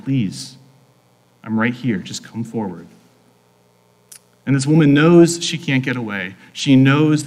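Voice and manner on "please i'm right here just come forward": soft, low appeal, fading at the end; tender, unhurried invitation; gentle coaxing. Acted. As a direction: coax someone shy to step forward. Jesus gently coaxing the hidden woman.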